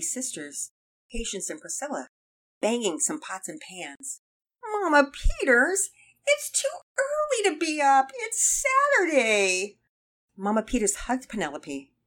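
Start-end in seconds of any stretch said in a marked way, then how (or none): 4.6-9.8 put-on very high, drawn-out kid's whine; whining child. A narrator's reading. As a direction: complain to Mom in a slow, high-pitched wail. Child protests being up early.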